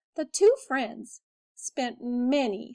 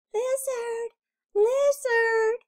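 usual speaking voice

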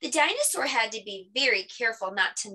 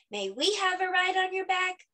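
put-on high-pitched child's request; children asking eagerly; 10 semitones higher than usual. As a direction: ask like hopeful kids. Children beg the dinosaur.

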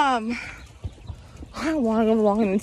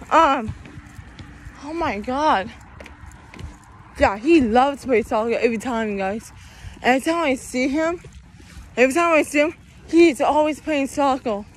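ordinary talk

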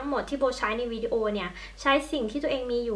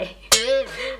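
laugh; 5 dB louder than usual. Real, not acted. Laughing at own makeup mistake.

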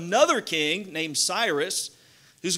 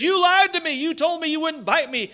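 high, loud acted cry of betrayal; outraged accusation; 10 semitones higher, 5 dB louder than usual. Dying man accusing the snake that bit him, acted outrage.

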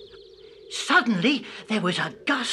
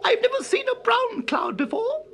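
much higher chirpy bird voice; cartoon bird voice; 11 semitones higher than usual. A narrator's reading. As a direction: chirp it like a little bluebird. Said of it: "A bluebird came flying by and chirruped".

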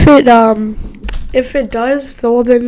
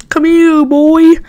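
coaxing call to a dog; calling a pet; 4 semitones higher, 4 dB louder, much slower than usual. Acted. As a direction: call him over like a dog.

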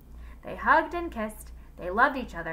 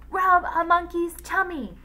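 high, loud pained cry; shout; 9 semitones higher, 8 dB louder than usual. A narrator's reading. Injured woman on the ground yells a silly phrase, shouting. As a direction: yell it out through the pain. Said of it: "she shouted".

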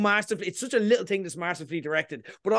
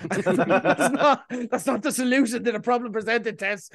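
laughing; 5 semitones higher, 3 dB louder than usual. Real, not acted. Laughing scold at Tess.

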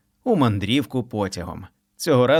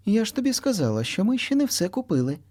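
lightly voiced mom, a bit higher; firm reminder to the child; mother reminding; 10 semitones higher than usual. A narrator's reading. Mother reminds kitty, lightly voiced. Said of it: "mom scolding".

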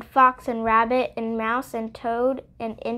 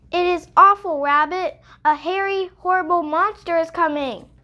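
frightened mouse; 6 semitones higher, 4 dB louder than usual. Acted. Mouse warns Rabbit.

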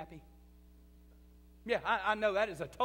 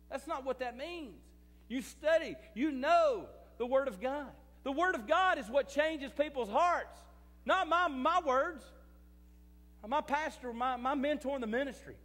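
full-force preaching; 7 semitones higher, 6 dB louder than usual. Acted. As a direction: preach it home with full conviction.

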